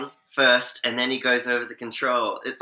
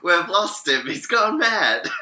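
higher, louder playful dismay; mock alarm; 4 semitones higher, 4 dB louder, slower than usual. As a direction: cry out in pretend panic, ending in laughter. Acts out alarmed viewer reaction.

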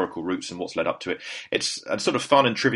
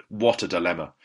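ordinary delivery

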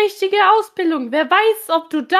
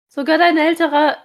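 normal talking voice

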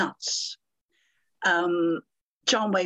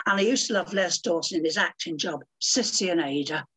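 ordinary talk

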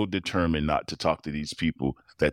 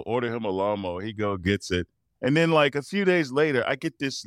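usual speaking voice

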